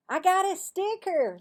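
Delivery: put-on high, loud four-year-old; high excited little sister showing off; little girl voice. A narrator's reading. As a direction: squeal it like a proud little girl.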